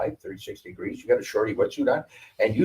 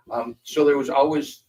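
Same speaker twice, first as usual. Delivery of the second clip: usual speaking voice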